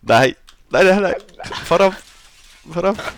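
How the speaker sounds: loud high panicked shouts; panicked yelling. Real, not acted. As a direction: yell in real panic. Caught by the hunter, yelling.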